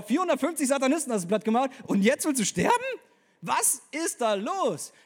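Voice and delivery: can't believe what he hears; incredulous disbelief. Acted. Preacher asks Elia in disbelief, loud and high.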